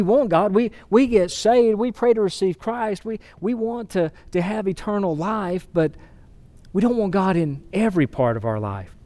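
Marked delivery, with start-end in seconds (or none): none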